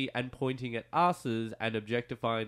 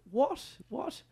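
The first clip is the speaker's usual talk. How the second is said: puzzled listener; 10 semitones higher than usual. Retold or imitated. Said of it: "anybody else listening out there is going".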